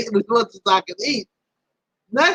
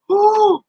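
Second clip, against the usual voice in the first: high, drawn-out whew; exclaimed sigh of relief; relieved whew; 11 semitones higher, 4 dB louder than usual. Real, not acted. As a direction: let out a big high whoop of relief. Relief there was no phone technology.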